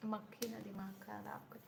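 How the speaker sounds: quick aside to shoo a fly away; softly grumbled at a pesky fly; muttered aside. Real, not acted. Shooing a fly mid-stream.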